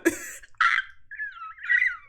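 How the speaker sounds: very high squealing laugh; helpless laughter. Real, not acted. Londoner cracking up at own failed accent, high helpless laugh. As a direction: crack up completely.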